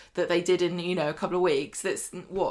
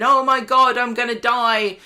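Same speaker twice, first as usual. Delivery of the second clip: mock screaming panic; 3 semitones higher, 7 dB louder than usual. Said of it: "instead of screaming, screaming".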